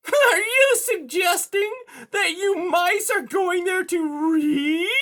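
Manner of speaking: high, loud, slow incredulous cat; scornful high villain voice; hissing cat. A narrator's reading. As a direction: draw it out with shrill menace. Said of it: "Silversides hissed".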